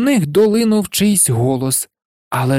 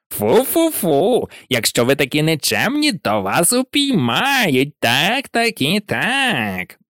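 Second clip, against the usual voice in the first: mocking poltergeist. A narrator's reading. Peeves mockingly scolding naughty first-years, sing-song.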